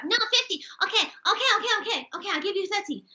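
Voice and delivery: high-pitched Chinese-accented market haggler. Shown as a demonstration. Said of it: "Chinese. You gotta do Chinese".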